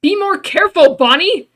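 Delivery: high, loud alarmed scolding; scolding yell. Acted. Friend scolds Bonnie after the hit, worried yell. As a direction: yell it out, unable to hold back. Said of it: "you can't help but yell".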